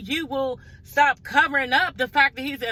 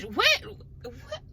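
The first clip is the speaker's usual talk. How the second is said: sudden high squeak of confusion; can't make out a single word; baffled disbelief; 12 semitones higher, 4 dB quieter than usual. Real, not acted. Vlogger mocking a mumbled TV line, baffled high what.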